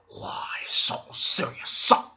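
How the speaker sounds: slow whispered taunt rising at the end; Joker's second whispered taunt; creepy Joker whisper. Retold or imitated. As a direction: hush it like a knife at the throat. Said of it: "He comes at me with the knife".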